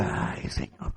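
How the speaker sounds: whispered sigh. Retold or imitated. Preacher retells whispering to God in the pew. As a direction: sigh it out under your breath. Sighing prayer in church.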